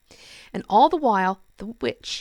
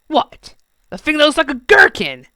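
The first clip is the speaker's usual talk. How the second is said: loud, high sneering goblin; 9 semitones higher, 12 dB louder than usual. Acted. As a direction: sneer it in disbelief. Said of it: "sneered the Goblin King".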